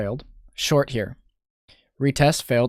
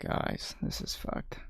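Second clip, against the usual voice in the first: whisper; 14 dB quieter, slower than usual. Real, not acted. Quiet dismay at a losing trade.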